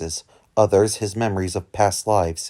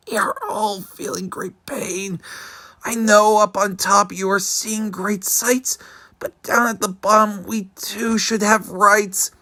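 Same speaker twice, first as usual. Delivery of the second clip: high, strained pleading voice; pleading Mac; 13 semitones higher, 7 dB louder than usual. A narrator's reading. Mac begs the king from the bottom of the stack. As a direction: beg from under the heavy stack. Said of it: "groaned Mac".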